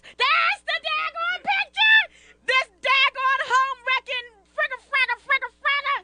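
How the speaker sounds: high, loud indignant yell; shrieking disbelief; outraged shout. Real, not acted. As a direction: blow up at the photo. Outraged at the photo.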